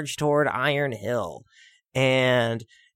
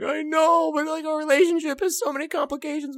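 high, quick whiny protest; whiny sad passenger; 12 semitones higher, 3 dB quieter than usual. Retold or imitated. A sobbing passenger whining about family troubles. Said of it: "And you're like".

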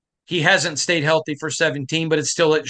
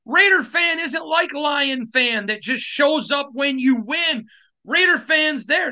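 ranting shout; 8 semitones higher, slower than usual. Real, not acted. Host rants comparing Raider and Lion fans.

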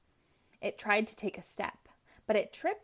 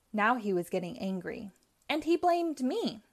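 plain reading voice

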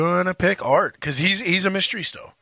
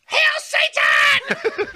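demonic shout; 6 dB louder, much slower than usual. Acted. Demonic cheer for the Violator.

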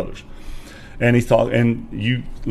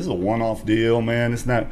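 ordinary talk

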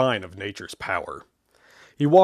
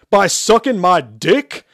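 much louder, higher, slow yell; loud shout; 7 semitones higher, 10 dB louder, slower than usual. Acted. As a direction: yell it for the whole plane. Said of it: "Shepard shouted loudly".

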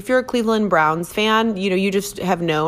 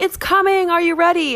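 high-pitched billboard countdown hype; mock hype; 11 semitones higher than usual. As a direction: announce it like a big teaser, pitched way up.

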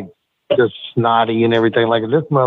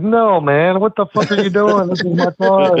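raised, higher protest at a messy passenger; indignant, pitched-up complaint; mock outraged yell; 8 semitones higher than usual. To Ricky wrecking his car, an outraged higher protest.

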